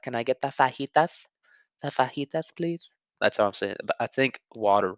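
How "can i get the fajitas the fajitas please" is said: higher mock Spanish-accented ordering voice; showy, lisped put-on Spanish accent, rising into a question; over-pronounced Spanish accent.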